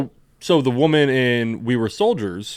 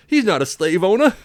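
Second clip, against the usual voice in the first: mocking sarcastic voice; 11 semitones higher than usual. Mocking the movie's sanitized version.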